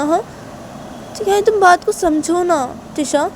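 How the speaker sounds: high, slowed coaxing voice; acted wheedling explanation; pleading. A narrator's reading. Shanaya pleads her sister's case.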